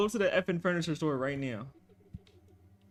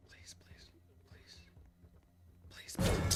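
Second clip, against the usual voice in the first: whispered pleading; 11 dB quieter, slower than usual. Real, not acted. Begging under breath while fleeing.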